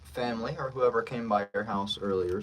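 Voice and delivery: ordinary talk, nothing marked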